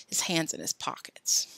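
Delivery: ordinary delivery, nothing marked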